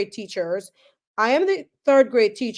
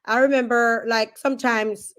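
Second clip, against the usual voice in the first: ordinary talk, nothing marked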